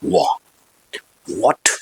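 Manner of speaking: वो and What said in a whisper with rounded lips; soft drill. Shown as a demonstration. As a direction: breathe out What softly through oval lips. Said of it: "ऐसे लिखना चाहिए". (फुसफुसाकर "वो", "वॉट")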